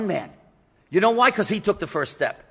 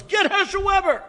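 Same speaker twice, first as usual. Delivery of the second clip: retold yell; 11 semitones higher, 3 dB louder than usual. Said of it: "somebody yells".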